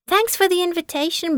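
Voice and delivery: ordinary delivery, nothing marked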